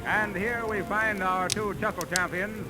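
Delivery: normal talking voice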